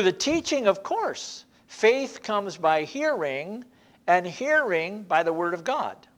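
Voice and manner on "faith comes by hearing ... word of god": ordinary talk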